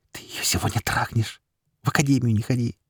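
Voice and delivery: eager hushed whisper; whispered excitement. A narrator's reading. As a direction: whisper it, bursting with glee. Fairy keeps whispering excitedly.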